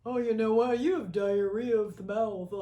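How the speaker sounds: lightly voiced smug man; smarmy dude. Host mimics a smarmy dude insulting her, lightly smug. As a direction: sneer it like a condescending guy. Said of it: "from some smarmy dude".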